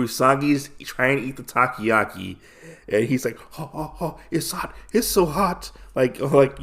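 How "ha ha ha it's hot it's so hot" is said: put-on puffing on too-hot food like a child; higher, soft, breath-blowing mock distress; little child. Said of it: "like a little child".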